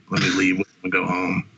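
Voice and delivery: normal talking voice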